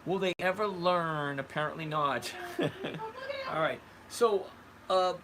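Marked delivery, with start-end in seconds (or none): 2.4-3.5 short high-pitched giggle; amused giggle. Real, not acted. Host laughing at those who never learn, high giggle. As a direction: giggle at your own jibe.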